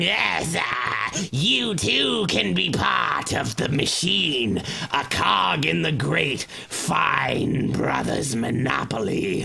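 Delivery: slow, lightly voiced villain hand-wringing; a hint of a scheming toad monster, slowed right down. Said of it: "these two toad monsters".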